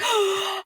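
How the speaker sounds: sharp imitated gasp for air; mock gasp. Guest retells running out of breath on live, gasping. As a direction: gasp like you ran out of breath. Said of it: "run out of breath".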